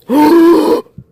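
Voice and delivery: loud high startled gasp; sharp gasp. Real, not acted. Gamer thinks Ronaldo appeared, sharp gasp. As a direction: gasp in hope.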